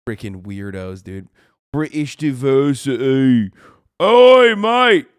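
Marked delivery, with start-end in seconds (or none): 1.7-3.5 loud, drawn-out mock British accent; slow and loud, parody British accent. Streamer mocking British people over their accents, mock British accent. 4.0-5.1 loud, high "oi, mate" British yell; mock British shout. Streamer parodying an offended Brit, loud mock British accent.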